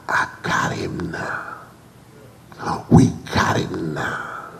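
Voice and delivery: slow low sinister whisper; devil's whisper. Retold or imitated. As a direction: hiss it like the devil scheming. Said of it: "the devil says".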